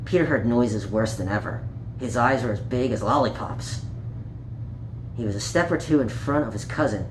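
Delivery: put-on reading a picture book aloud to children; gentle storytime read, page by page; story narration. A narrator's reading. Storyteller reading Peter Rabbit to young listeners, gently.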